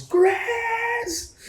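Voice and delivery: very high, stretched-out calling yell; top-of-lungs shout. Acted. Halfling calling the goblin upstairs, drawn-out shout. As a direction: call the name high and long, as if to another floor. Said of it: "shouts at the top of his lungs".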